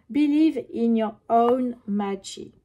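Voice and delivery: slow English read with a French accent, carefully spelled out. Shown as a demonstration. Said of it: "excusez mon accent". (anglais lu lentement avec un accent français)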